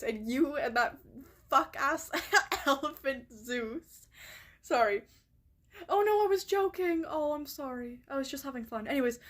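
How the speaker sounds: normal talking voice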